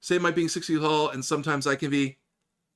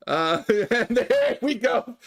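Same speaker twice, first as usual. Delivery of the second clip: slow, higher words broken by laughing; real laughter; 7 semitones higher, 3 dB louder, much slower than usual. Real, not acted. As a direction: crack up while talking. Laughing as listeners give up.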